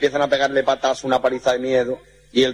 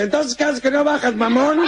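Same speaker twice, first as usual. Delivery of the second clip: scolding Mexican; 12 semitones higher than usual. Acted.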